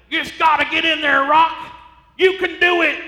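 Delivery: loud, high corner-man shout; trainer Mick yelling. Retold or imitated. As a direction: fire up the beaten boxer. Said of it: "Mick's like".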